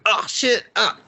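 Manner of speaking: high-pitched imitated fright; mock startled. Acting out Howard hearing the dead body, mock startled. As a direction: play the shock in broken, high little cries.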